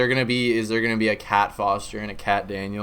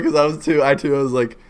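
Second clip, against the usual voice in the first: usual speaking voice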